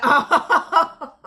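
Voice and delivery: loud, high mocking cackle; mocking laugh. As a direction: burst into a big acted-out laugh. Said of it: "I started laughing".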